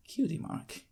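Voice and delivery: turning the odd phrase over quietly; quiet puzzled repeat. Acted.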